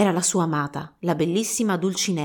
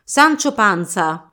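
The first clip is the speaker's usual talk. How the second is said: slow, louder, solemn summons; grand announcement; 4 dB louder, much slower than usual. Acted. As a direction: call the squire with ceremony. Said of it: "annunciò".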